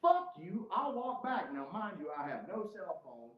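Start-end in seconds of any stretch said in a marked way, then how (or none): 0.0-1.5 high-pitched, indignant retort; worked-up retold kiss-off; defiant. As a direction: fling it back, pitched high. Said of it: "I was like".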